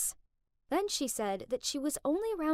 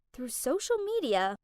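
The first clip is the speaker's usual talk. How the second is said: usual speaking voice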